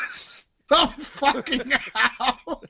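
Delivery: words squeezed out through laughing; high, broken, stretched by giggles; helpless laughter. Real, not acted. Host cracks up at the segue, laughing.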